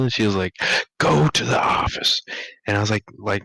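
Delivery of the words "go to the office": higher, stern hissed order; stern command. Retold or imitated. Lunch lady ordering him, stern hissed command. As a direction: send him off coldly.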